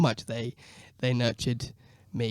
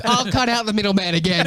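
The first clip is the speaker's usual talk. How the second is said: high, loud mock generosity; sarcastically gift it back, high and loud; hammed-up cheerful sarcasm; sarcastic high cheer; 7 semitones higher, 3 dB louder than usual. Sarcastic reply to threat.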